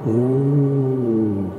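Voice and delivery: long sung "oh"; sung wail of voices. A narrator's reading. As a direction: hold the vowel like ghostly singers. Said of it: "chantèrent les voix".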